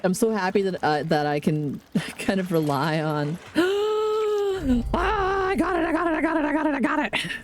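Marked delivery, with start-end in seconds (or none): 3.5-7.3 gasp, then high and rapid, easing down; catching the fish she wanted, squealing with excitement; excited squeal. Real, not acted. High excited squeals.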